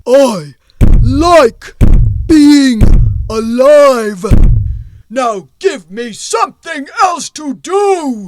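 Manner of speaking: very loud, slow, word-by-word stone golem; furious golem. Acted. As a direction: pound out each word like a raging giant. Golem bangs apprentice on ceiling.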